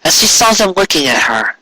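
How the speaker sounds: plain reading voice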